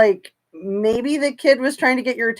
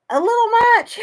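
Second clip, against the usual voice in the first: high, squeaky mock girlish voice; mimicking her coy answer in a high squeak; mocking her reply; 14 semitones higher, 5 dB louder, faster than usual. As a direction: squeak it out like a coy little girl. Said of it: "And she says".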